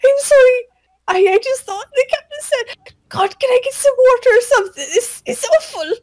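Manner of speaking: Toby begging the cook for water. Acted. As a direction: beg for mercy in a panic.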